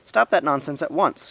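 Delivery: high, loud indignant call; shout. A narrator's reading. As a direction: shout down an order to stop. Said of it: "he shouted".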